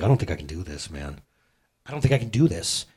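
low, soft, worn-out confession; defeated. Retold or imitated. Pastor retelling telling his wife he can't cope, defeated. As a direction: mutter it like you're giving up. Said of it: "I was like".

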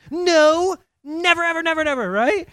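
loud, high emphatic denial. Real, not acted. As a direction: hammer home that births are never silent. Emphatic denial of silent births.

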